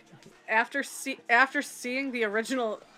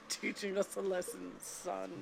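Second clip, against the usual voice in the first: stern father voice; 2 semitones lower, 9 dB quieter than usual. Retold or imitated. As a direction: lecture like a gruff father. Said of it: "sounds like a stern father".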